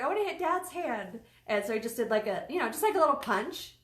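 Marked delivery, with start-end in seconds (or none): 0.0-1.2 put-on much higher child's voice; imitating her son. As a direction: sing it like an insistent little boy. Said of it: "he's like".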